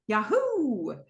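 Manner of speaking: exclaimed Yahoo. Retold or imitated. [name] showing Tripp how to say it, cheering. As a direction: whoop it as a demonstration. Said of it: "Yahoo with an exclamation point".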